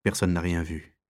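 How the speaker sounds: plain reading voice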